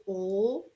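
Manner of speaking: "ol" drawn out alone as the end of turtle; isolated final sound. Shown as a demonstration. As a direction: sound out just "ol". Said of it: "that's the last sound".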